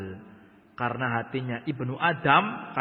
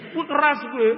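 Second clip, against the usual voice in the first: defiant boast; 11 semitones higher, 6 dB louder than usual. Retold or imitated. Imitating a defiant person.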